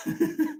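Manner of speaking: short high laugh breaking out; burst of laughter. Real, not acted. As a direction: laugh escapes mid-lesson. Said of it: "मेरी फिर हंसी control नहीं होगी".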